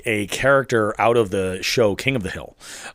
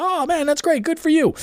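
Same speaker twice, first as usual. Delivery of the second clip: much higher, overly bright fake enthusiasm; humoring him. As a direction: play along politely with the bragging guy. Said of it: "just humoring the guy".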